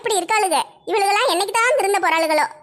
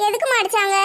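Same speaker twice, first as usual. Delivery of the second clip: ordinary talk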